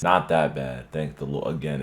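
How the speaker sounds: usual speaking voice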